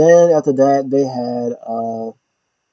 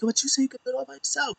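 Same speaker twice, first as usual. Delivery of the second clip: much higher, fast and hushed; mocking retort; 12 semitones higher, 12 dB quieter, faster than usual. Finn snapping back at his teammate, fast high mock. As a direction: fire it off quick and high. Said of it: "He was like".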